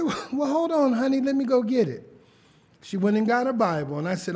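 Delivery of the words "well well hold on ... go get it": higher, lightly voiced old grandma; a hint of a sweet old grandmother; grandma's voice. Grandma tells grandson she'll fetch her Bible, higher. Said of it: "She said".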